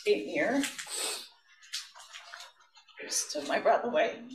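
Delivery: real grief catching in the voice; choked up. Real, not acted. Witness names injured student, struggles on.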